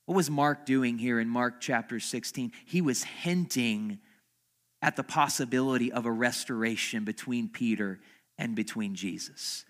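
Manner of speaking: usual speaking voice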